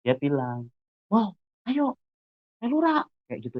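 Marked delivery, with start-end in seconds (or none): 1.1-3.0 high-pitched friend's eager invitation; ajakan bersemangat. Retold or imitated. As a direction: act out a pal urging you along, pitched well up. Teman mengajaknya ikut acara. (ajakan teman dengan nada tinggi)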